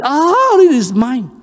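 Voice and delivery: a high, boastful "it is mine"; mock possessive boast; boastful pride. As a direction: claim it like a proud child. Proud owner voiced in sermon.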